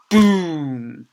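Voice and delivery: a loud voiced boom; sound effect. Narrator voicing the desk thump with a loud boom.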